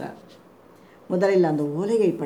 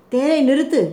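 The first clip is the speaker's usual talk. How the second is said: louder, higher-pitched order; called-out command; 7 semitones higher, 6 dB louder than usual. A narrator's reading. Passenger tells the charioteer to stop, loud command. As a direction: call out a sudden order to halt.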